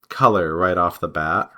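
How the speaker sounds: ordinary talk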